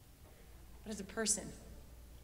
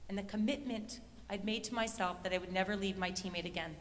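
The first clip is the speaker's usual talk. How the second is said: ordinary delivery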